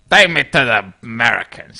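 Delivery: a mock American Western voice, loud; a rough try at an American cowboy; John Wayne impression. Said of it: "Do John Wayne".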